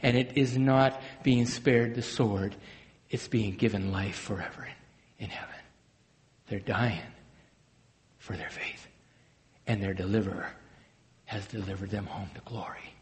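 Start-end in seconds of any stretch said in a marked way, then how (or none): 3.1-12.9 softer, trailing into a whisper; reverent hush. Acted. Preacher honoring martyrs, in a reverent whisper.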